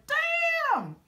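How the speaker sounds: high, stunned exclamation; impressed high damn; shocked damn. Retold or imitated. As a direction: yelp it out in disbelief. Reaction to handball coach.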